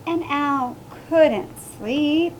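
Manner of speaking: slow, suspenseful picture-book narration; story reading to children. A narrator's reading. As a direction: draw it out for young listeners, hushing at the end.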